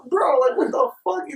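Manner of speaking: high-pitched retold exasperation; incredulous. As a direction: protest to a friend in disbelief. Retells exasperation at drunk friend.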